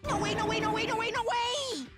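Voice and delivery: high, frantic repeated refusal; excited. Acted. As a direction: panic like a flustered girl. Girl panics at request.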